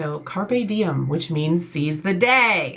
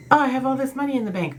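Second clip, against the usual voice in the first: higher, fast, a hint of a smug rich person; mock bragger; 7 semitones higher, much faster than usual. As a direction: breeze through it like showing off. Mock dialogue about money.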